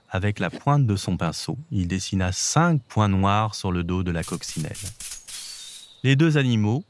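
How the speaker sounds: usual speaking voice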